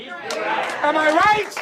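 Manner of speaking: high and stretched very slowly; long, high crowd-baiting question; drawn-out rally cry. Acted. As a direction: hold every word like a game show host. Rousing the crowd.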